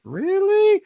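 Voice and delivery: high, disbelieving "Really?" shot way up; incredulous. Retold or imitated. Actor reacting to director's no-accent rule, incredulous. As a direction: squeak out your disbelief.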